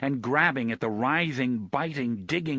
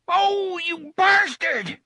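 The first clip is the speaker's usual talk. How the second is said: much higher, loud cry of pain; pained shout; 8 dB louder, slower than usual. Acted. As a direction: yell it stabbed. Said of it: "shouted in surprise and pain".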